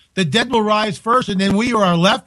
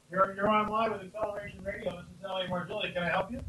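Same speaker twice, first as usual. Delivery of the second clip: much softer, heard from across the room; far from the mic; 16 dB quieter than usual. Real, not acted. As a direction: answer the phone away from the mic. Answering phone away from microphone.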